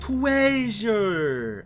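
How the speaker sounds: a hint of Fred's excited call; Fred from Scooby-Doo. Streamer imitating Fred to chat, mild excited call. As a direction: proclaim it like Fred finding loot. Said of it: "How does Fred say it in Scooby-Doo?".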